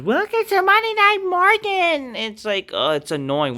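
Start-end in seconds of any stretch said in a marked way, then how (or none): 0.0-2.1 very high, loud sing-song parody; mock her catchphrase in a squeaky voice; mocking Liv Morgan. Vlogger parodies Liv Morgan's catchphrase, high sing-song. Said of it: "Liv Morgan says".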